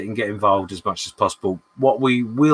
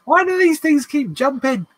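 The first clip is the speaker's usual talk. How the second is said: high frustrated squeak; 5 dB louder than usual. Real, not acted.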